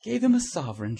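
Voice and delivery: loud, high incredulous shout. A narrator's reading. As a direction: shout it back in disbelief. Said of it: "shouted Trevor".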